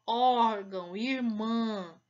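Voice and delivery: órgão, irmã with heavy nasal ão and ã; ão e ã nasalizados; nasal vowel demo. Shown as a demonstration. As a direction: exaggerate the nasal ending, slow. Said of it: "o som sai aqui".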